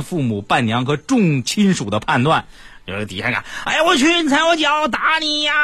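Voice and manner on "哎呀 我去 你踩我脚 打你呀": mock fight shout. Groomsman faking a fight below the window, high mock shouting. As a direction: fake a brawl to cause noise. Staged fake fight downstairs.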